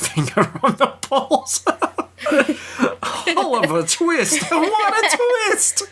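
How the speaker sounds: high giggling through the words; laughing. Real, not acted. Cohost cracks up over the Oliver Twist gag, laughing high. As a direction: wheeze with laughter at your own pun.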